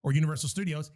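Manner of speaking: normal talking voice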